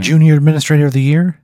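high, loud, quick disbelief; incredulous question. Acted. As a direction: ask it like stunning news. Acted dispatcher amazed at the name.

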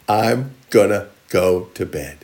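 "I'm gonna go to bed" drawn out word by word; slow and deliberate. Acted. Slow, deliberate repeat of the line.

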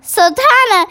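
high, excited giant's cry of praise; joyful cry. Acted. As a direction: cry it out with delight. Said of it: "cried the B F G".

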